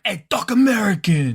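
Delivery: high, punchy New York Italian voice; New York Italian accent. Acted. Showing what he'd really say, New York Italian accent. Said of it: "New York Italian kind of guy".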